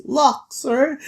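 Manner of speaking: put-on high little-boy voice; small boy answering his elder; Locke. Locke gives the Thiefmaker his name, high boy voice. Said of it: "And Locke says".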